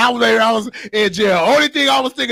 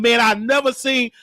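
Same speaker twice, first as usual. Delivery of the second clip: ordinary talk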